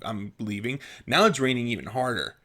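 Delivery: ordinary delivery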